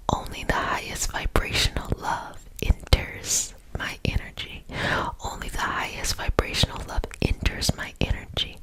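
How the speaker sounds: ordinary talk, nothing marked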